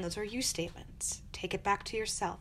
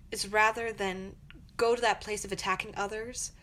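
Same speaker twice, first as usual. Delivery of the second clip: ordinary talk, nothing marked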